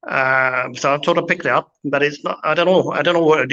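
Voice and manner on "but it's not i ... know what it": usual speaking voice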